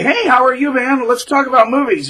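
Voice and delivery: friendly greeting retold. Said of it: "I would say".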